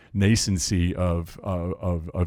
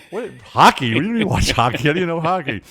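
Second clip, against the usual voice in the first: mock incredulous; 8 semitones higher, 4 dB louder than usual.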